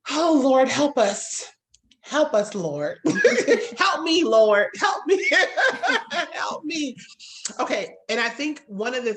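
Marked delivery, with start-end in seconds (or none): 0.1-7.0 high, loud, laughing cry for help to the Lord; laughing plea. Real, not acted. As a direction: mock-desperate prayer through laughter. Laughing plea about own anger.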